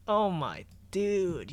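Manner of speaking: ordinary talk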